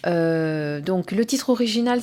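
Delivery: ordinary talk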